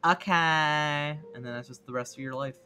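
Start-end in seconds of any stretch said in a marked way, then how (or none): 0.1-1.2 drawn-out put-on little kobold voice; mock-obedient minion; resigned kobold servant. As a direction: stretch each okay as a meek creature agreeing. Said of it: "And it's like".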